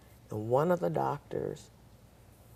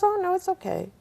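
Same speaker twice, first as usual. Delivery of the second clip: much higher, louder mock reassurance; reassuring doctor; 7 dB louder, faster than usual. Doctor reassuring nurse Geneva, much higher and louder. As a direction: wave her worries away.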